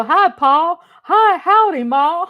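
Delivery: a hearty hint of a Texas drawl greeting; country greeting. Imitating a country greeting to friends, high and loud. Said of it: "country thing".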